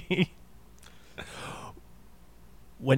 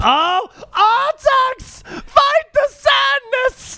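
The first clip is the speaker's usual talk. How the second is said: high, slow, stretched-out wail; anguished shout; 5 dB louder, much slower than usual. Retold or imitated. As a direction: scream it like a desperate boy losing his horse. Quoting Never Ending Story scene.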